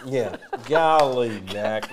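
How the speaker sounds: slow, laughing Virginia drawl on golly; mock Virginia accent. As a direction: ham up a Virginia accent with a chuckle. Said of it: "I could probably do it a little bit better than you".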